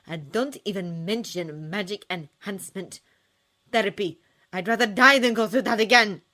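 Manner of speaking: put-on girl rejecting therapy, building to a shout; angry outburst. A narrator's reading. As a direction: build from trembling to a loud cry. Jack lashing out, trembling.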